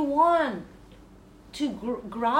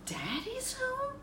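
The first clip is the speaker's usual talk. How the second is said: disbelieving echo; 13 semitones higher, 8 dB quieter than usual. Acted. Player echoes the yell in disbelief.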